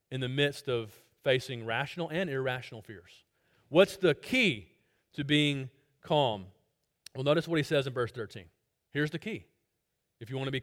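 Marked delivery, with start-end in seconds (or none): 3.7-6.5 slow rhetorical question. Real, not acted. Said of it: "Now, what is the key".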